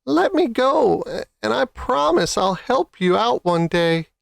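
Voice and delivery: high squeaking bargain; squeaky mouse. Acted. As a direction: plead in a tiny high voice.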